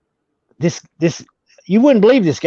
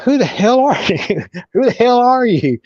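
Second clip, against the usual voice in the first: higher-pitched incredulous question; baffled demand; 6 semitones higher than usual. Retold or imitated. Storyteller asking the over-friendly stranger who he is, incredulous. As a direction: challenge a stranger in mock bewilderment. Acted bewildered reply to a stranger.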